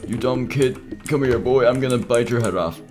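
menacing villain voice. Acted. Animatronic threatening kid.

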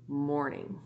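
ordinary delivery, nothing marked

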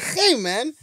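normal talking voice

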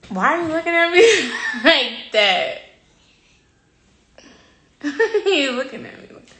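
giggling through the question; laughing. Real, not acted. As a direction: laugh while asking why. Laughing at a stare.

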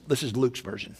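normal talking voice

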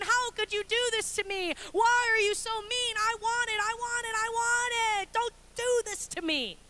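put-on high, loud, wailing toddler tantrum; hammed-up sobbing protest from a three-year-old; mock toddler crying. Toddler to mom over refused brownie, hammed-up crying meltdown. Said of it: "this ridiculous crying".

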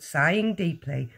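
usual speaking voice

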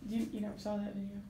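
ordinary delivery

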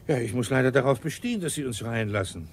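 usual speaking voice

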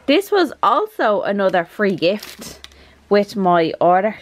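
ordinary talk, nothing marked

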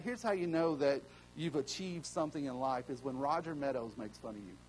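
ordinary delivery

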